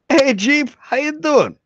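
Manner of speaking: doctor voice. Acted. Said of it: "I'll even use my acting voice".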